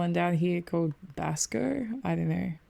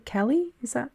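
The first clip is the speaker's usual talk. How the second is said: questioning; 8 semitones higher than usual. Real, not acted. Repeating a guess, higher.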